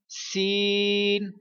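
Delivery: سین drawn out with a whistling hiss; teacher makes سین hiss; whistled s. Shown as a demonstration. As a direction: let سین whistle, slow and long. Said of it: "سین پر بھی سٹی نکالوں گا".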